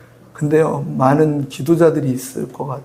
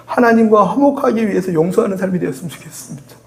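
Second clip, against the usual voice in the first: usual speaking voice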